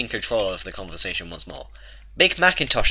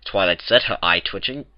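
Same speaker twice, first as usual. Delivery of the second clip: ordinary delivery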